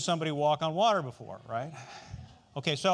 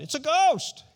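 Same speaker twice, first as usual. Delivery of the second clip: raised, louder read-aloud cry of alarm; fearful cry; 10 semitones higher, 9 dB louder than usual. A narrator's reading. Disciples spot a figure walking on the sea, fearful cry. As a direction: narrate the disciples crying out, a touch of fear. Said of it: "they cried out in fear".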